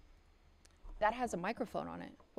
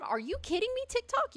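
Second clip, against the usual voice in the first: much higher, louder protest; indignant outburst; 4 dB louder than usual. Real, not acted. Streamer scolding TikTok, high indignant cry. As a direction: call the app out in disbelief.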